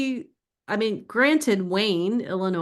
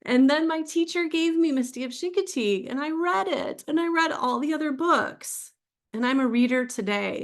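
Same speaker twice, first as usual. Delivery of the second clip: choked up; 8 semitones higher than usual. Real, not acted. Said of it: "This is where I get choked up".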